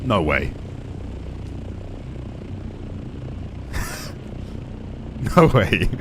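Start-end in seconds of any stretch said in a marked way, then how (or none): 0.6-5.3 breathless, high-pitched squeal of laughter. Real, not acted. Gamer name guessed, disbelief.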